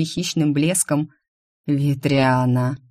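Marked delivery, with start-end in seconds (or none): 1.7-2.8 slow, drawn-out name. Acted. Rion caught by her teasing, drawing out her name. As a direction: stretch the name lazily, like a predator. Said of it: "протянул Рион".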